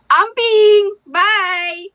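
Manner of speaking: big mock send-off shout; called-out goodbye. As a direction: call a goodbye across the room. Calling out the new goodbye word.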